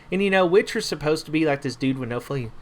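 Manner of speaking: normal talking voice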